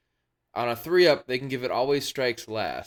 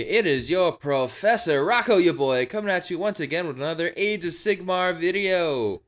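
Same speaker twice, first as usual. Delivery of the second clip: big, loud show-opening announcer; hyped-up host intro; 5 semitones higher, 5 dB louder than usual. Pretend.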